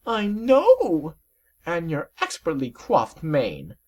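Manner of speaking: very high, slow refined lady voice; drawn-out fashionable lady; high, affected voice; prissy Rarity. Acted. Rarity flatters serpent.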